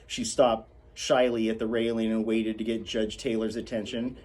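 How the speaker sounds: ordinary delivery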